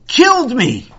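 high, loud dramatic accusation; explosive cry of blame; outraged shout. Acted. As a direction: burst out in wounded outrage.

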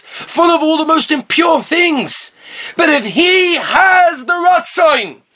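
high, drawn-out, fervent exhortation; impassioned preaching. Real, not acted. Preacher urging listeners to want closeness to Hashem, impassioned. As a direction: preach it with mounting fervour, stretching key words.

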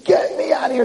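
high but restrained mock scream; retold scream. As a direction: imitate a scream without full volume. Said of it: "And he starts screaming".

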